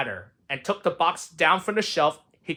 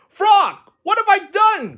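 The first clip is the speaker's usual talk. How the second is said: very high, loud wail; anguished cry; 8 dB louder, slower than usual. Acted. Toad finds Frog gone, high distressed cry. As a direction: cry out in distress for a lost friend. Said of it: "cried Toad".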